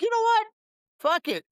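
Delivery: acted-out sudden resolve, pitched high; impulsive decision. Host voicing bookers talked into a brawl, impulsive. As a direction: jump up high like someone throwing caution away.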